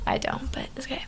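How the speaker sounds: quick whispered aside. Real, not acted. Teasing her cohost in a quick whispered aside. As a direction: whisper it fast under your breath.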